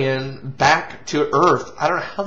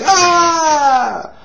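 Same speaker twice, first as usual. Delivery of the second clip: high, loud panicked scream; crowd scream; 11 semitones higher, 7 dB louder than usual. Retold or imitated. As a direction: scream like people fleeing in the street. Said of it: "you hear people screaming on the streets".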